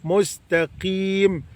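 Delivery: مستقيم slowly, the ق under a pure kasra; slow full kasra. Shown as a demonstration. Teacher showing the pure kasra, very slowly. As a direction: drag out مستقيم, clear kasra on ق. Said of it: "كسر خالص".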